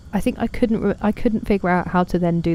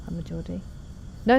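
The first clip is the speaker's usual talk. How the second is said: usual speaking voice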